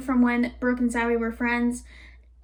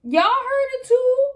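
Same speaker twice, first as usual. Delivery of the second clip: high, loud protest; defensive outcry; 11 semitones higher, 4 dB louder than usual. Real, not acted. Vlogger defending herself to viewers, loud protest. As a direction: protest loudly that it's not your fault.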